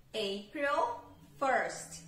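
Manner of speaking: slow, careful "April first" for learners; English ordinal date. Shown as a demonstration. Teacher models the date for students, slow and clear. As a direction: spell out "April first" slowly, teacher style. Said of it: "Então, primeiro de abril".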